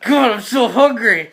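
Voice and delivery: ravenous and worked up; ravenous whining. Acted. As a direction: moan it like you're starving. Player acts out ravenous character.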